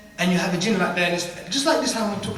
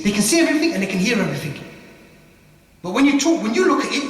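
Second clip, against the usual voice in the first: usual speaking voice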